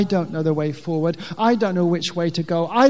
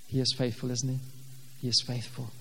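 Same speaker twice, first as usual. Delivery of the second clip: quiet assurance; 8 semitones lower, 11 dB quieter, faster than usual. Real, not acted. Quiet affirmation to congregation.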